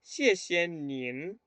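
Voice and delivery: "Xie xie nin" slowly, with "nin" rising at the end; model pronunciation. Shown as a demonstration. Teacher showing the polite thanks, slow model pronunciation. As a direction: draw out "Xie xie nin" for learners. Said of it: "Gracias a usted".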